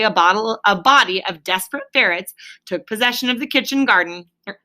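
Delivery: usual speaking voice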